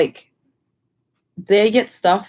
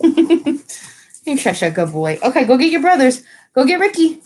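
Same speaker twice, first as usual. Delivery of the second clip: pet talk to dog; 7 semitones higher, 4 dB louder than usual. Real, not acted. Owner sending her dog to fetch the boys, high urging. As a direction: praise the pup, then send him off.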